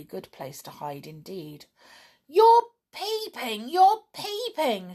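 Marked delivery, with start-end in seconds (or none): 2.3-4.9 much higher, loud, slow accusing yell; angry shout. A narrator's reading. Donald angrily yells at Tom for peeping. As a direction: shriek the accusation at him. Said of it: "shouted Donald angrily".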